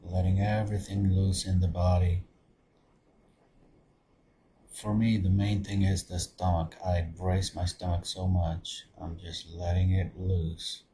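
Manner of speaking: soft, low-key meditation voice with long pauses; guided meditation. Acted. Meditation leader settling listeners into open awareness, guided meditation. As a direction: guide them gently inward, softly, leaving space between phrases.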